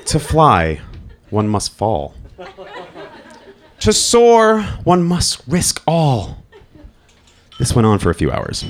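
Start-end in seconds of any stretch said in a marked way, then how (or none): 0.1-6.4 slow, high, loud mock-poetic recital; hammed-up fatherly proverb reading; mock grand poetry recital. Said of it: "started reading me aphoristic poetry".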